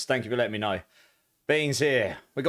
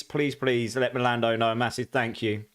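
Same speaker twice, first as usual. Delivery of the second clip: ordinary talk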